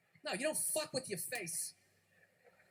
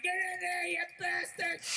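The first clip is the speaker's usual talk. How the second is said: higher, mock-angry order; acted angry father; 5 semitones higher, slower than usual. Father making his kids rehearse all day, mock-angry order. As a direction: order the kids about like a bullying dad. Acts abusive father driving children on.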